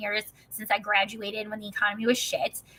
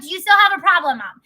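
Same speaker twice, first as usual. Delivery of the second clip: loud, raised-pitch challenge; retold angry face-off; confrontational; 5 semitones higher, 8 dB louder than usual. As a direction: throw the question at her angrily. Acted challenge to her mother in quarrel.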